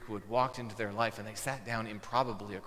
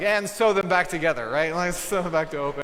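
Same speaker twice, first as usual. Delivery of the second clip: playful excited retort; 5 semitones higher, 9 dB louder than usual. Retold or imitated. Jokingly adds to boy's remark.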